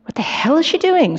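high-pitched incredulous question; baffled food man. A narrator's reading. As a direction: pitch it way up, bewildered and unnerved. Non-dominant man baffled by signing.

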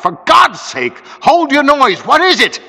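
high, loud, frantic demand; crying out. A narrator's reading. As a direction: shout him down in alarm. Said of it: "hardly less loud".

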